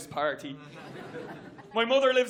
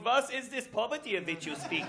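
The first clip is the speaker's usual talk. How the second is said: mock German; 6 dB quieter than usual. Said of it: "Germany's going".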